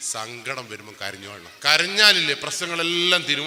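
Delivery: ordinary delivery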